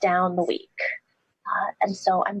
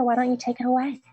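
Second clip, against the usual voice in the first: ordinary talk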